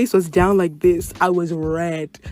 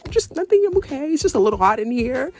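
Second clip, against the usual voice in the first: high, shaky insistence that all is fine; nervous denial; 10 semitones higher than usual. Retold or imitated. Shaking girl tells her friends she is fine, high and nervous. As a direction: cover the panic, voice jumping high.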